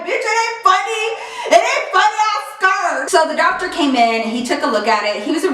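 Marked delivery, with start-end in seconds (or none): 0.0-3.1 high, laughing mock outrage; teasing the laughing nurses, laughing the whole way; laughing protest. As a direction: laugh through the complaint.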